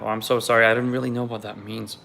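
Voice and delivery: ordinary talk, nothing marked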